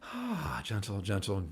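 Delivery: quiet self-soothing; soft self-calming. Real, not acted. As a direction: hush yourself down to calm. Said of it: "Regain composure".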